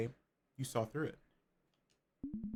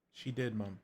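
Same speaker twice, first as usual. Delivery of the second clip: gentle reassurance; 3 semitones lower, slower than usual. Acted. Elu gently reassuring Mom that Jaja kept everything, tender. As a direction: soothe her as you say it again.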